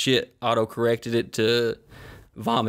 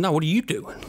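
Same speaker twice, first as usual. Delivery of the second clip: high, mocking echo back; incredulous dad; 6 semitones higher than usual. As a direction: throw the question back at him. Dad turns question back.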